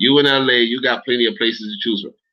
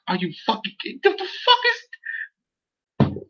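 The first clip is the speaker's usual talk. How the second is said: helpless high laugh swallowing the words; incredulous laughter; 4 dB quieter, slower than usual. Real, not acted. Streamer reacting to being called a blonde, cracking up. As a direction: squeal it through breathless laughter.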